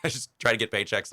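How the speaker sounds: ordinary delivery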